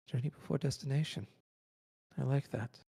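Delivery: small spirit voice, light and fast; pixie. Acted. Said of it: "tiny little voice of Still".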